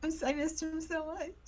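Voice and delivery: usual speaking voice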